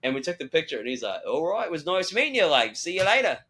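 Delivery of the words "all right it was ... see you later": higher, hammed-up bad Australian goodbye; terrible Australian accent. Actor saying goodbye to a fan, in a bad Australian accent. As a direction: sign off in badly faked Australian. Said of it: "I just did a terrible Australian accent".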